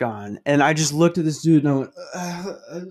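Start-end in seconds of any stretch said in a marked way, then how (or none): 2.1-2.8 flustered, high stammer, kept soft; acting out caught-out stammering; soft, high, panicky noises. Flustered reaction after coughing on stranger.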